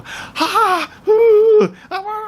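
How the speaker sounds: high, loud whooping; high mock hollering; mock party shouting. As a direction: imitate a noisy party with shrieks and hoots. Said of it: "like, uh, shouting each other".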